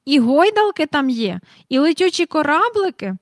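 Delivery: eager questions, high and loud; excited astonishment. A narrator's reading. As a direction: ask like a boy bursting with excitement. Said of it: "аж підскочив Майкл".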